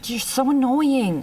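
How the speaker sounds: whisper yelling. Real, not acted. Sister snaps in a whisper.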